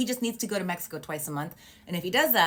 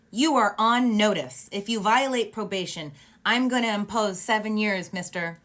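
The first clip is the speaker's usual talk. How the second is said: stern warning; 4 semitones higher, 6 dB louder than usual. Retold or imitated. Retelling judge warning Mister Driz on probation, stern. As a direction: lay down a stern warning, emphatic and deliberate.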